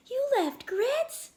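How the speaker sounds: can't believe they left his dog; shocked. Acted. Boy to the climbers about his dog, shocked.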